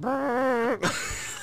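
squeaky high-pitched laugh; high giggle. Real, not acted. Fart while lifting couch.

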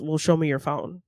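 usual speaking voice

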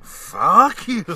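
loud, high, drawn-out curse; hammed-up furious blast; re-enacted rage. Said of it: "brought it up to my face and went".

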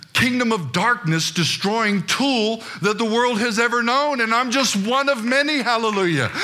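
high-pitched, impassioned sermon delivery; full-force preaching. Real, not acted. Preacher rousing the church to claim God's riches, full-force preaching. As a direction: preach it out high, riding each declaration to a peak.

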